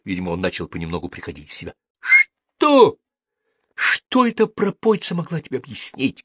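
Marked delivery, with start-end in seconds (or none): 2.0-6.2 much higher, louder outraged yell; shouting. A narrator's reading. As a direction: explode at him in disbelief. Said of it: "кричал Макс". (гневный крик, намного выше и громче)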